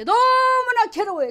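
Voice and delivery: high, slow, drawn-out cry of torment; a tormented soul crying out; anguished cry. Acted. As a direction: wail it like someone in chains. Acting out tormented possessed person.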